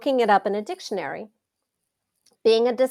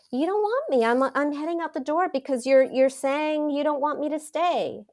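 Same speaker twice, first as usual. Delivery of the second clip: mock sad plea; 6 semitones higher than usual. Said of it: "like, so sad".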